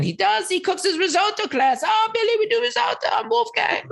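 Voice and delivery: squeaky, over-the-top bad German accent; Wolfgang, horrible German accent. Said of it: "it's my horrible German accent, Wolfgang impression".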